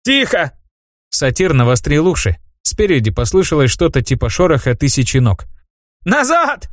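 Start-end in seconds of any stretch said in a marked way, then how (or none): 0.0-0.5 sharp hush. A narrator's reading. Satyr hushes the group. 6.0-6.7 high, alarmed yell; urgent shout. A narrator's reading. Satyr warns of spiders.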